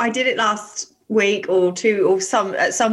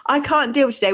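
normal talking voice

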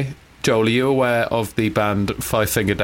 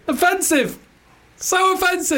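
high, helpless laughing objection; amused outrage, pitched way up; laughing protest. Real, not acted. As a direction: squeal it through laughter. Laughing at the friend's accent phrase.

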